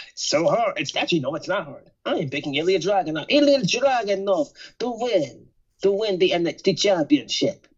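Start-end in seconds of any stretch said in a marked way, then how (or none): 3.3-7.7 hammed-up Russian accent that drifts Jamaican, slow and higher; lightly put-on Russian accent, stretched out; mock Russian accent attempt. Podcaster hams a Russian accent announcing his pick. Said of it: "That's Jamaican".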